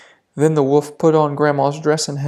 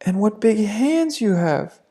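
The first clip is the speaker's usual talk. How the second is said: put-on little girl exclaiming; 6 semitones higher than usual.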